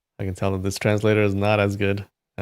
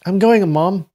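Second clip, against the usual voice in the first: put-on high young man's voice, a bit less childish; high, older youth; 11 semitones higher, 9 dB louder than usual. A narrator's reading. Young man telling his mother he's going, louder high voice. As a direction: call it out louder, high but not a small child. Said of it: "he's not that young".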